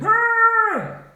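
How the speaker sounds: very high, loud startled scream; shouted scream. A narrator's reading. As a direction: scream like Dad jolted out of bed. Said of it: "Dad jumped out of bed and shouted".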